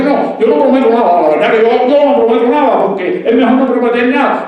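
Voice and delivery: loud, high mock of a churchgoer dodging promises; lightly voiced believer refusing to promise. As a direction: parody the excuse of a lukewarm believer. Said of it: "yo he visto a gente cristiana así".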